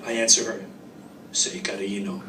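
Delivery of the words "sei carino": flirty whisper. Acted. Simone flirting, calling him cute.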